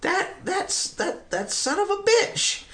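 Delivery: very high, strained mock disbelief; high-pitched grudging exclamation; mock outraged squeal. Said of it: "I'm like".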